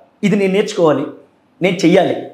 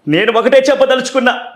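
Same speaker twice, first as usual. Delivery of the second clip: Jagan impression; 6 semitones higher, 4 dB louder than usual. Shown as a demonstration. Mimicry artist performing for viewers and fans, Jagan impression. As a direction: declaim to a crowd in short, high-pitched bursts with pauses. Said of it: "Jagan గారితో మాట్లాడుతున్నామా అన్న feeling".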